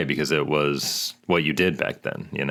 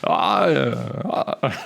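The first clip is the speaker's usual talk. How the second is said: high, faltering film-star hemming; Jimmy Stewart; 8 semitones higher, much slower than usual. Shown as a demonstration. Podcaster showing off an impression to co-host, halting stammer. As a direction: stammer wordlessly like an old movie star. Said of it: "That was really good".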